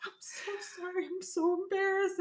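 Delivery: high, small, flustered squeak; imitated embarrassed apology. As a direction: play a man shrinking with embarrassment. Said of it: "He was so apologetic".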